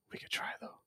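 hushed breathy whisper. Real, not acted. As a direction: whisper it under your breath. Whispered aside before plain repeat.

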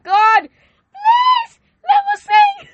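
high, loud pleading shout; desperate cry. Retold or imitated. Retelling her prayer to sing, desperate shouting plea. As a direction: cry out to God, begging. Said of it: "I was just like".

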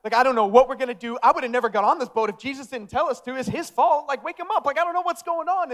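high, loud panicked protest, climbing as it goes; mock scared. Peter to the disciples as the boat fills, acted panic. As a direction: act out losing your nerve and blaming someone else. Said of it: "and he's just scared".

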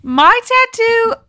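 high, insistent denial; indignant protest. Acted. Protesting innocence.

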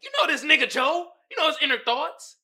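ordinary delivery

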